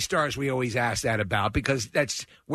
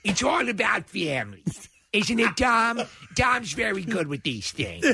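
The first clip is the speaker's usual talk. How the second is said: higher, hammed-up Broadway diva impression; 4 semitones higher than usual. Co-host as the star in Fast and Furious, diva impression. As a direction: play the stage star in an action-film parody. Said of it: "[name] in the Fast and Furious".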